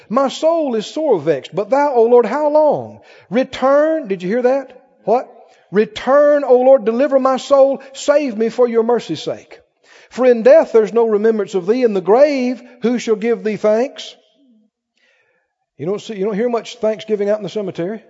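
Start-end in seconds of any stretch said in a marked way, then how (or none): 0.1-4.1 raised, rhythmic preaching read; preached scripture. Acted. Preacher reading the psalm to his church, raised. 5.7-14.2 raised, rhythmic preaching read; preached scripture. Acted. Preacher reading the psalm to his church, raised.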